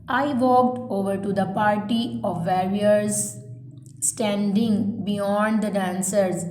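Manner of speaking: slow, spaced-out dictation read; measured classroom dictation pace. Shown as a demonstration. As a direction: read each phrase slowly so students can write it down. Teacher reads dictation aloud.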